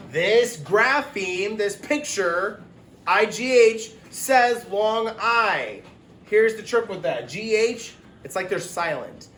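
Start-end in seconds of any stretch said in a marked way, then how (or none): 0.1-5.8 slow, higher, louder words for I G H; slow teacher emphasis. Shown as a demonstration. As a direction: spell out I G H carefully and slowly. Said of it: "teacher shows the letters".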